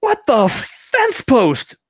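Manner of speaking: very high, slow, stretched-out squeak; long drawn-out gnome line. Retold or imitated. Gnome losing his head top, drawn-out silly squeak. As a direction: drag it out in a silly cartoon voice. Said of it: "it's this long drawn out".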